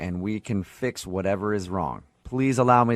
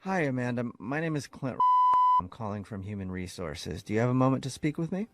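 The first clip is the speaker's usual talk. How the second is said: softer, formal HR voice; hint of an HR officer; 4 dB quieter than usual. Prank host poses as Human Resources calling, soft and formal. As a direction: speak as a cool HR caller.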